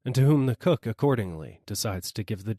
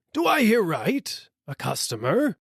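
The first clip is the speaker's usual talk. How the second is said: startled dealer; 11 semitones higher, 3 dB louder than usual. A narrator's reading. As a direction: ask hopefully over the shoulder. Dealer asks wife.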